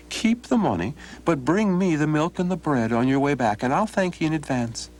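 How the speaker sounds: put-on butter up the old man; sly friendly stranger; cheery Donald. Donald deals with the wagon driver.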